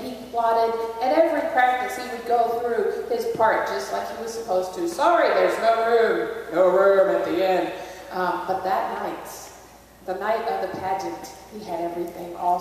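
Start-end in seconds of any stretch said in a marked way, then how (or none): 4.9-7.7 a hint of a gruff innkeeper; lightly voiced boy turning people away; boy rehearsing his turn-away line.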